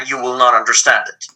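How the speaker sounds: usual speaking voice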